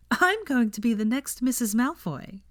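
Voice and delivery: gleeful. Acted. Hermione gleeful, higher.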